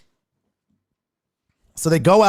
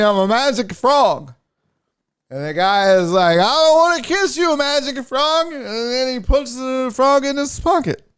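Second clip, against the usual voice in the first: heckler telling his joke; 10 semitones higher, 4 dB louder than usual. Retold or imitated. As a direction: ham up a lame frog joke. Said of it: "he goes".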